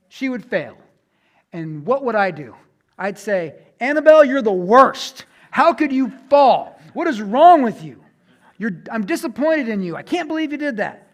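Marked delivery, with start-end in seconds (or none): 3.8-11.1 put-on loud, high, scolding a toddler who fell; mock scolding. Father berating his toddler for falling, loud mock anger. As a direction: yell at the little girl in mock disappointment. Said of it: "No, that's ridiculous".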